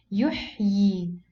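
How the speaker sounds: yuhyi slowly, final ii held long; double-ya demonstration. Shown as a demonstration. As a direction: say yuhyi as if it ended in two yas. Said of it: "As if we have two yas".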